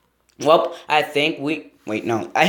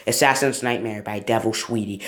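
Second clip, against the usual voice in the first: usual speaking voice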